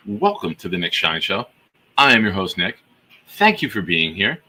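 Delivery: ordinary delivery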